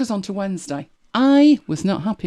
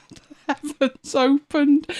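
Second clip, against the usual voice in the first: slow, higher, through laughter; laughing; 8 semitones higher, slower than usual. Real, not acted. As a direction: laugh your way slowly through it. Laughs at story's twist.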